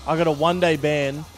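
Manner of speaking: normal talking voice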